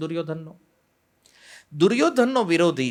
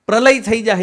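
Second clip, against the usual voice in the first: louder, higher burst on Pralay; mock exclaim; 7 semitones higher, 9 dB louder than usual. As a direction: throw it out as a dramatic retort. Mahaprabhu retorting to the brahmins. (ઊંચા અવાજે નાટકીય ઉદ્ગાર)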